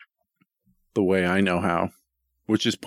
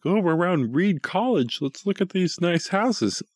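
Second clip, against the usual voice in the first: eager sightseer; 10 semitones higher than usual. Retold or imitated. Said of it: "I'm like".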